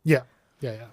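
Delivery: offhand, understated confirmation; nonchalant. Real, not acted. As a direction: confirm it casually, as if it were no big deal. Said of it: "You say that very nonchalantly".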